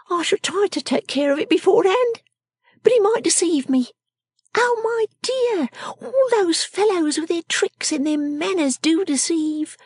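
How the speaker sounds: high, emphatic little character voice; worldly-wise Miss Jenny. Acted. Miss Jenny warning Lizzie about deceiving fellows, high and emphatic. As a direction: insist in a high, knowing voice.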